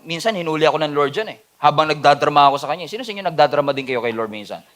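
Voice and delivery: normal talking voice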